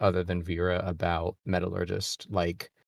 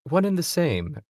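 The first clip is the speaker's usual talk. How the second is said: in-character Vera; 9 semitones higher, 4 dB louder than usual. Acted. Vera answers the Kagan in character.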